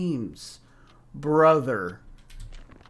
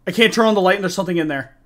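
fast, louder, panicked; rising panic; 2 semitones higher, 6 dB louder, much faster than usual. Real, not acted. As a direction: rush it out in fear. Repeats in growing panic.

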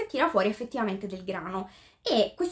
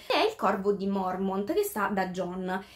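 ordinary talk

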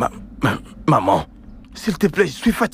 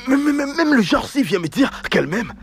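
higher, sneering stutter; mocking stammer; 8 semitones higher than usual. Son mocking the girl who says she loves him, scornful stutter.

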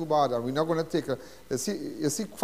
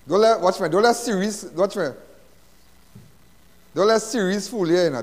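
boastful shout; 5 semitones higher, 7 dB louder than usual. Real, not acted. Boasting loudly about his body.

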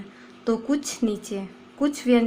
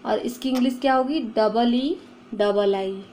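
normal talking voice

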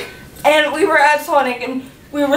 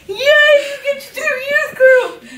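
high, squealing cheer of joy; excited cheer; 11 semitones higher than usual. Retold or imitated. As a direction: squeal it like thrilled friends. Friends cheering for her.

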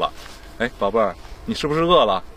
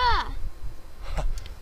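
laugh; 6 dB quieter than usual. Acted. Father laughs at child.

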